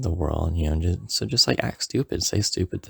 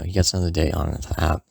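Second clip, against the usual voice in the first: normal talking voice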